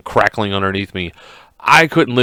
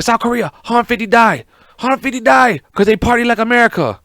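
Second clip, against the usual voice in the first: a higher mock Korean-accent impression, gloating; a hammed-up Korean dictator voice, sneering at South Korea; a mock Korean accent, gloating over the news; mocking Kim Jong; 10 semitones higher than usual. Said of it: "I'm making fun of Kim Jong".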